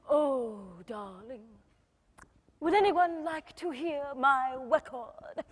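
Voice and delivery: slow, languid star impression; mock-grand, unhurried purr. Imitates [name] offering her record.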